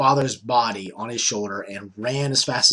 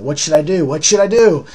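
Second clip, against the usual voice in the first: higher, louder second plea; panicked retelling, climbing in pitch; unsure, frantic asking; 4 semitones higher, 7 dB louder than usual. Retold or imitated. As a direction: ask helplessly, voice climbing. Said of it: "just unsure of himself".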